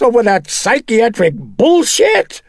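high, full-force enraged shriek. A narrator's reading. Chris throwing the psychiatry back at the priest, shrieking. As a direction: shriek it out, beside yourself. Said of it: "Chris shrieked".

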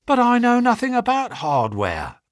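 high-pitched, bewildered objection; flustered protest. A narrator's reading. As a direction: protest your ignorance in a high voice. Clerk protests the offer.

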